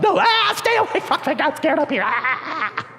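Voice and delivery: high-pitched panicked wail; mock panic. Scared kid begging to be left alone, mock panicked squeal. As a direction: scream it in comic terror.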